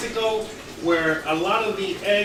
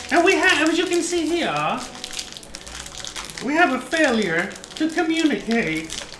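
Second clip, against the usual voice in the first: much higher, hammed-up movie line; mock dramatic; 10 semitones higher than usual. Cook to viewers, hammed-up high movie line. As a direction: ham up the famous quote, pitched high.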